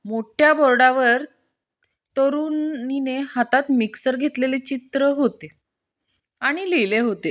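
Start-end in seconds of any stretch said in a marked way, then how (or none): none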